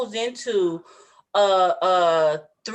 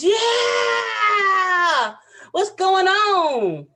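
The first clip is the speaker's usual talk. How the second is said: long, high, drawn-out cheer; excited welcome; 9 semitones higher, much slower than usual. Real, not acted. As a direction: greet the guest with a big stretched whoop. Host hails the guest's arrival.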